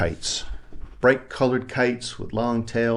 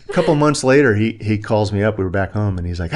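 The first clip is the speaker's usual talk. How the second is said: normal talking voice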